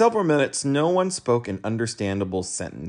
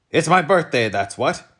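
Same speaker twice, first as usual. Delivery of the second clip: high, loud retort; indignant policeman; 7 semitones higher, 7 dB louder than usual. A narrator's reading. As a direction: snap back at Milo. Policeman replies.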